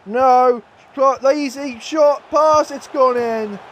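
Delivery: high, loud cries as the goal goes in; dismayed shout. Real, not acted. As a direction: shout in dread as they score. Opponent scores in extra time.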